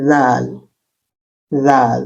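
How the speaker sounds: জাল with a soft th, tongue between the teeth; soft dhal. Shown as a demonstration. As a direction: say জাল softly like English th, slowly. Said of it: "soft". (জিভ দাঁতের মাঝে রেখে নরম জাল)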